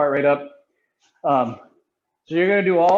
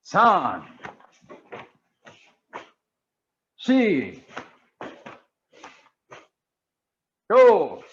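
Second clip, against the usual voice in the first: sharp martial arts commands; 2 semitones higher, much slower than usual. Acted. Karate class counting in Japanese.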